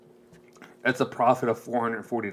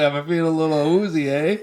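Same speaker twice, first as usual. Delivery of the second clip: woozy, eh, higher with a Canadian lilt; mock Canadian; 4 semitones higher, 4 dB louder than usual. Repeating the pun as a Canadian watching football, hammed up. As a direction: play a Canadian football fan. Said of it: "it's a Canadian watching".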